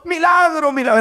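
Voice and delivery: high-pitched mock shouting of the crowd; mock excited cry. Excited parishioners crying out in a parish, mock high cry. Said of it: "Todo el mundo está gritando".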